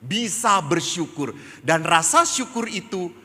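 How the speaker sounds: high, loud preacher's proclamation; emphatic preaching. Real, not acted. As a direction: drive the point home loudly, pitched well up.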